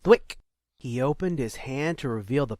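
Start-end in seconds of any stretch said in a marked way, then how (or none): 0.0-0.4 sound effect. Acted. Pebble snatched from air.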